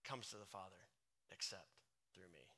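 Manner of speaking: soft, low, almost a whisper; quiet reverent scripture reading; hushed reading. Acted. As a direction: draw it down to a hush. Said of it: "check this next verse out".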